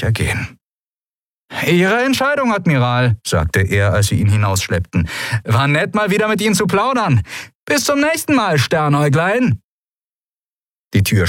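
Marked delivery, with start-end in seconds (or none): 1.7-3.2 very high pirate voice; self-assured pirate. A narrator's reading. Pirate to the admiral as he is dragged out, self-assured. 5.4-9.6 high, sing-out pirate farewell; a cocky captive's mock-friendly goodbye; taunting pirate. A narrator's reading. Pirate to the woman while being dragged out, taunting.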